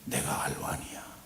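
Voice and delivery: hushed unvoiced whisper; whispered voice of God. God whispering to the weary pastor. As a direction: breathe it like a secret from above. God speaking to him softly.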